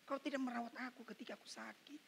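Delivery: normal talking voice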